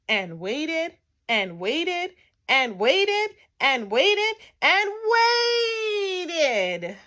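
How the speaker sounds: escalating, drawn-out repetition; building exasperation. A narrator's reading. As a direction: climb to a long wailed final waited. Endless waiting builds to a climax.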